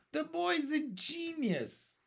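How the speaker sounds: high, slow proclamation in a put-on voice; centipede announcing. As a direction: announce it with grand delight. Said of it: "the centipede announced".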